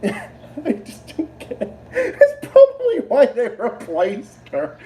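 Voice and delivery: high, breaking into laughter while talking; real laughter. Real, not acted. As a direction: struggle to speak through the giggles. Cracks up after own impression.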